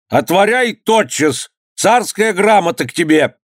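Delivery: high, drawn-out imperious order; commanding call. A narrator's reading. Governor ordering the gate opened, commanding call. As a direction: demand entry from outside, pitched high.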